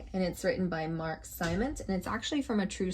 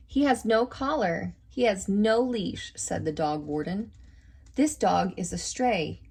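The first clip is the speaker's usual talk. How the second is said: lively storybook reading voice; reading to children; 3 semitones higher, 5 dB louder than usual. A narrator's reading. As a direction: read it out like to a class of kids. Reads picture book aloud.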